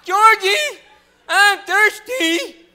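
high, drawn-out loud holler; real loud. Acted. Whining to friend George in the grocery store, real loud. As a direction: yell it high and slow, hammed-up. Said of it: "real loud".

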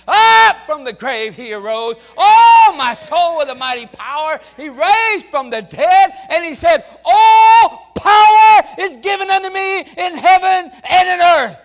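very loud, high, shouted preaching; full-force preaching. Acted. Preacher proclaiming the resurrection, shouting at full force. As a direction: climax of the sermon, preach it out.

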